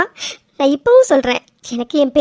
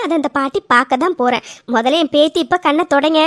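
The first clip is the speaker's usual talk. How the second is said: normal talking voice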